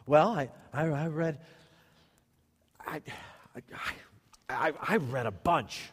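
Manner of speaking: halting, with long pauses between restarts; stammering evasion. Acted. Church member asked how much they read, stammering evasively. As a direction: play someone fumbling for an answer. Acts member caught without an answer.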